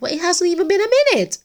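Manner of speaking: high, fast amused voice of God; God's amused reply. Retold or imitated. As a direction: toss it off high and quick, amused. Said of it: "And God is like".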